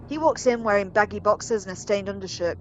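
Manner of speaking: usual speaking voice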